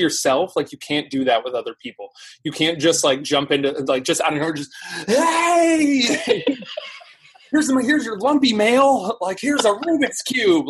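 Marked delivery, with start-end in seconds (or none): 5.1-10.7 high, slow, laughing parody of a clumsy pitch; giggly mocking sendup, slowed down; mock pushy pitch. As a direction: ham up an awkward gift-giver, much higher. Mocking pushy influencer outreach.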